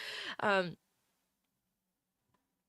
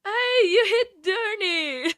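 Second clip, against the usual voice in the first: much higher, louder and slow, near tears; overwhelmed happy shout; excited; 10 semitones higher, 9 dB louder, slower than usual. Real, not acted. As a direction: cry it out in happy disbelief. Emotional reaction to a donation.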